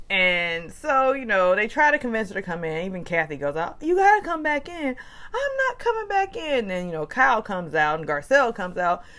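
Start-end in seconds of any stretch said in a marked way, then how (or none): 3.8-6.7 mock plea. Pleading and refusing, mimicked.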